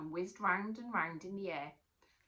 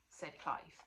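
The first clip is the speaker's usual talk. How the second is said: ordinary delivery, nothing marked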